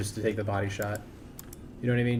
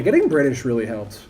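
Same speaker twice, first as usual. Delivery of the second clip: normal talking voice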